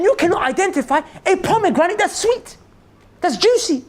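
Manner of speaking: high, loud disbelieving rant; incredulous master. Retold or imitated. The master, outraged at his gardener, rants in disbelief. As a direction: demand an answer in outraged disbelief.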